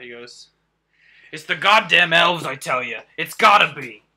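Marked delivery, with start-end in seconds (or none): 1.3-4.0 dwarf shouting out. Acted. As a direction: yell it out like a fed-up dwarf blaming the elves. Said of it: "he sort of shouts out".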